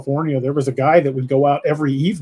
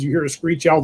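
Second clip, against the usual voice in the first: usual speaking voice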